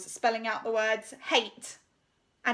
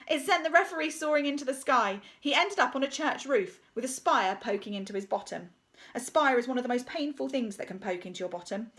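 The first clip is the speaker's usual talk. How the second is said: ordinary delivery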